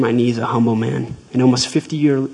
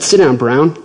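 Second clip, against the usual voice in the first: curt order; 3 semitones higher, 8 dB louder than usual. Retold or imitated. General orders officer to sit.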